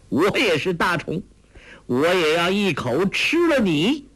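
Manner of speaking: high, playful mock menace; gleeful teasing. A narrator's reading. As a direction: threaten to gobble her up, in fun. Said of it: "欧阳克笑道".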